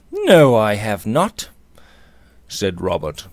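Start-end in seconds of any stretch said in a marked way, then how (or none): 0.1-1.5 slow, weighty "No, I have not"; "No, I have not" said slowly with unusual force; emphatic. A narrator's reading. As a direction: stress "No, I have not" firmly, louder and drawn out. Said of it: "with an emphasis by no means usual to him".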